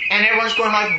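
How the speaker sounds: usual speaking voice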